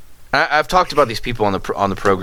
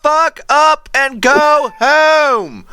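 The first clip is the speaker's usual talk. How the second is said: high, very slow, stretched-out holler; retold exasperated bellow; shouted retort; 4 dB louder, much slower than usual. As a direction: yell it back, dragging out every word. Acted yelled retort from the car.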